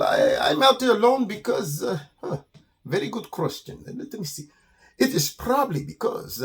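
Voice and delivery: put-on accented, halting, boastful skunk; stall, then preen in a deliberate accent; Armando the vain skunk. Skunk explains being alone as jealousy, vain.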